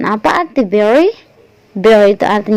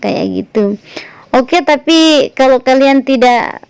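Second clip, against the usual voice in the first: ordinary talk, nothing marked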